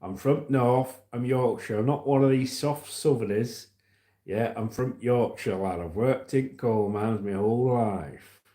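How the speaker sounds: proud Yorkshire coal miner; demonstrating a Yorkshire accent. Shown as a demonstration. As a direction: do a broad Yorkshire accent, slowing at the end. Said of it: "Yorkshire accents like this".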